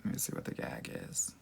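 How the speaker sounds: unvoiced, under her breath; muttered to self. Real, not acted. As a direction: read it under your breath. Reading comments to herself.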